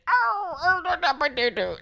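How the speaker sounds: high, laughing put-on kid voice; weird character voice. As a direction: imitate Wesley's nervous silly character. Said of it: "he does a weird voice".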